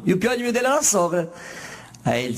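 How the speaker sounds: usual speaking voice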